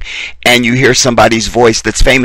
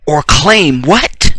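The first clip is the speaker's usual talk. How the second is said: normal talking voice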